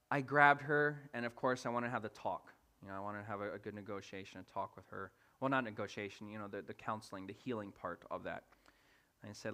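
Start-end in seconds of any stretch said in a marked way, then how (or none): none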